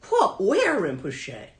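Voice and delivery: high, loud What, then a slow Where; baffled, scanning the sky; startled question. Retold or imitated. Student who can't see it asks her teacher, startled.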